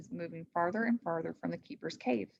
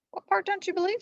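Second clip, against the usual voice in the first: Annie voice; 13 semitones higher, faster than usual. A narrator's reading. Annie's voice, high.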